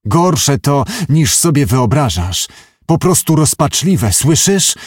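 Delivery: ordinary delivery, nothing marked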